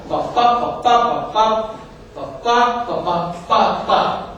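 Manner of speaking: "pá, pá" beaten out as weak and strong syllables; accent pattern demo. Shown as a demonstration. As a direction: tap out the verse's stresses on each "pá". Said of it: "com a seguinte pauta acentual".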